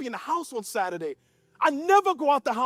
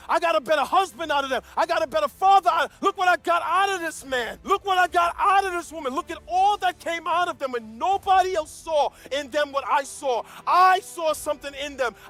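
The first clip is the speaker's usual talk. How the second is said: full-force preaching with raised pitch; impassioned preaching; 4 semitones higher, 5 dB louder than usual. Acted. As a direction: preach it in long, rising, emphatic phrases. Preaches at full force, voicing God.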